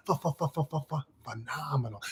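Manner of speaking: soft repeated puffs of "Ph-" before "phenomenal"; playful stuttered PH. Shown as a demonstration. Joking that phenomenal starts with P, stuttered PH. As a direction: stutter the f sound of "Ph-" softly, then release "phenomenal". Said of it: "It doesn't start with an F".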